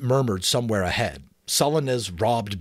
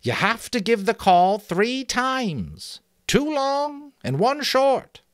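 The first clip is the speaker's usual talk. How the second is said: high, loud piping explanation; chuckling sentry; 13 semitones higher, 5 dB louder than usual. Acted. Sentry proudly explaining the giraffe call, high and pleased. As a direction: explain the clever trick in a thin high voice. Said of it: "the sentry chuckled".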